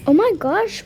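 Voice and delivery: worried surprise at the crying; alarmed. Acted. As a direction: jump in with alarm.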